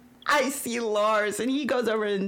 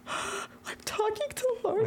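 awestruck hush; 13 semitones higher, 5 dB quieter, slower than usual. Retold or imitated. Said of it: "it wasn't like".

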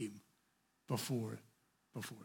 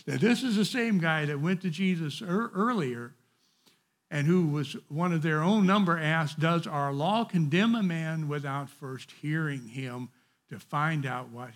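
higher and louder, driving the point home; fervent preaching; 5 semitones higher, 10 dB louder than usual. Real, not acted. Preacher telling his class not to put people down. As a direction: lift up the average person.

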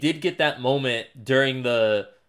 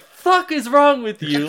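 high, loud, slow outraged shout; angry Mark; 13 semitones higher, 6 dB louder than usual. Retold or imitated. Mark yelling at his father, outraged high shout. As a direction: yell it at him in disbelief. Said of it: "He's like".

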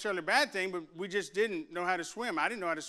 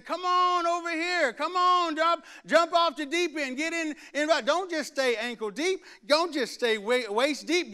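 higher, louder, slow urging call; preacher voicing heaven's loud invitation; calling shout; 7 semitones higher, 5 dB louder than usual. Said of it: "his spirit is saying".

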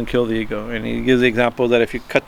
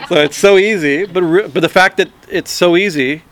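real laugh spilling into higher, louder speech; amused laughter; 6 semitones higher, 4 dB louder than usual. Real, not acted. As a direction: say it through a genuine chuckle, pitched up. Amused at the paradox, audience laughing.

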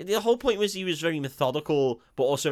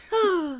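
high breathy sigh in mock French style; 14 semitones higher than usual. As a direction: sigh it like a swooning student. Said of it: "we all walked in and went".

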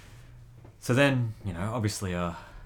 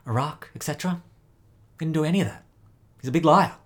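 lightly voiced gruff man, raised pitch; a hint of a gruff man, getting louder; 7 semitones higher, 7 dB louder than usual. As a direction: build to a loud, high dismissal. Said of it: "And the gruff man says".

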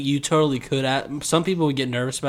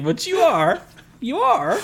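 high, rising sing-song tease; teasing; 11 semitones higher, slower than usual. Real, not acted. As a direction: call him out playfully. Teasing friend.

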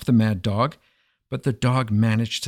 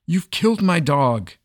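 king roars at Zorayek, higher and louder; 6 semitones higher, 5 dB louder than usual. A narrator's reading. The king roars at his prime minister over the poisoned dog, raised and loud. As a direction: bellow it out in outrage over the dead dog. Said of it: "roared the king".

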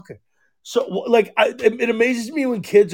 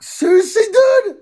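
much higher, louder disbelief; incredulous; 13 semitones higher, 4 dB louder than usual. Retold or imitated. Dad retelling son's confession, high incredulous outburst. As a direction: throw up your hands in disbelief.

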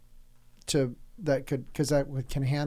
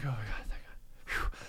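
relieved sigh; 5 semitones lower, 11 dB quieter than usual. Retold or imitated. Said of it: "I just was like".

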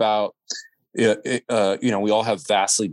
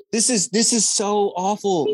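high, dismayed retelling; appalled reaction; 10 semitones higher than usual. Retold or imitated. Onlooker who had to leave the class, appalled. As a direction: voice climbs high in horror at it.

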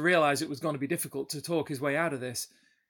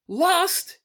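high, loud indignant shout; 8 dB louder than usual. Acted. Drunk Hrungnir to the sheriff, shouting in outrage. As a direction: explode at the word. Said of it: "he shouted".

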